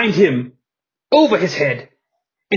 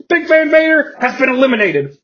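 usual speaking voice

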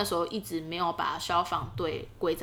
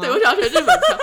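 high, loud laughing reply; 13 dB louder than usual. Real, not acted. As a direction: laugh through the words. Laughing admits the accent. (边笑边说)

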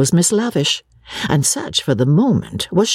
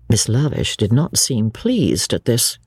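ordinary delivery, nothing marked

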